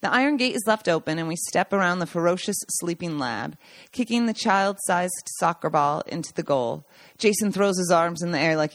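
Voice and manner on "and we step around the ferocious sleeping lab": ordinary delivery, nothing marked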